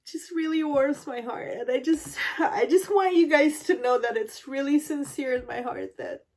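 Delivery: choked up, laughing through tears; emotional, close to crying; tearful. Real, not acted. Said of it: "I'm gonna start to cry".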